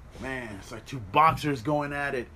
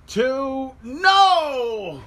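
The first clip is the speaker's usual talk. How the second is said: high count breaking into a loud "no!"; disbelieving outburst; 10 semitones higher, 7 dB louder than usual. Real, not acted. Fan watching a kick-out at two, disbelieving shout. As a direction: count the pin, then cry out in disbelief.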